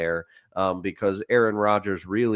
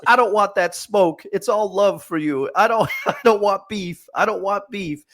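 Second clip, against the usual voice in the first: mock fan voice; 8 semitones higher, 4 dB louder than usual. Mocking a chirping fan's backdown.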